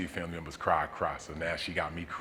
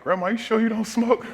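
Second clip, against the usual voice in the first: much higher and louder teasing disbelief; amazed disbelief; 9 dB louder than usual. Retold or imitated. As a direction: act stunned that grandma smokes like a pro. Amazed at grandma smoking.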